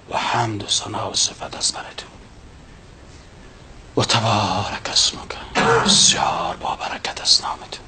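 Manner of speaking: hushed whisper, voice almost gone; whispered meaning. Shown as a demonstration. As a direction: whisper the meaning as if to God alone. (زمزمهٔ آهسته و نجواگونه)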